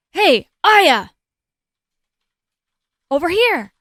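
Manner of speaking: loud, high hail; calling out. Acted. Character hails partner across the room, calling out. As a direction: call someone over from a distance. Game character calls out to Aya.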